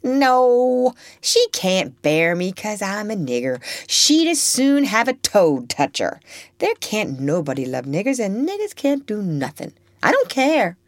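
put-on a hurt child brushing it all off; scornful Topsy. Child tells Eva nobody can love her, scornful. Topsy rejects Eva's reassurance.

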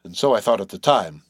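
plain reading voice

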